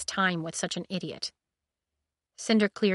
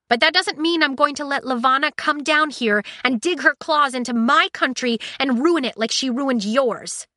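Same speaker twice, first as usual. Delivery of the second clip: raised, heated retort; 7 semitones higher, 6 dB louder than usual. Acted. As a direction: argue back hard and loud. Heated argument with Jason.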